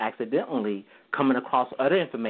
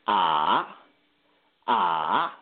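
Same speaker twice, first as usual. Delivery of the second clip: "ah" dipping low, then rising; dipping Mandarin tone on "ah"; third tone; 6 semitones lower than usual. Shown as a demonstration. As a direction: sink deep on "ah" and swing back up. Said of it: "which is more of a dip".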